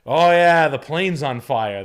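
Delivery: loud, high, offhand announcement; mock pilot calling in a fire; casual loud plane-on-fire. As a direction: shout it like no big deal. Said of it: "I'm like".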